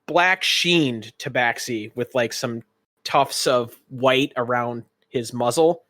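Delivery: ordinary talk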